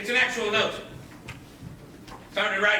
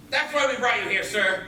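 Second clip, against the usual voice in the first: ordinary delivery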